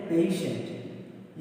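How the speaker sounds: पेशेंट drawn out with sh; teacher modelling the word; slow model word. Shown as a demonstration. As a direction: say पेशेंट very slowly as pay-shunt. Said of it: "इसको क्या बोलते हैं".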